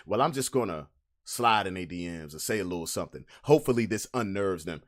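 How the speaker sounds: mock the ex under your breath; quiet mocking ex. Imitating the ex's sneaky DM plan, quiet and low. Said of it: "Now they like".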